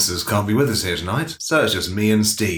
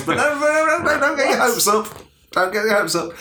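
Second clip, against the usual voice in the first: high-pitched tease; 12 semitones higher than usual. Acted. Teasing warning, pitch jumps high.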